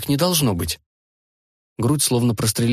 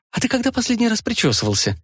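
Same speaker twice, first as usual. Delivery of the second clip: much higher, playful tease; teasing high voice; 12 semitones higher than usual. Acted. Rita teasing aloud.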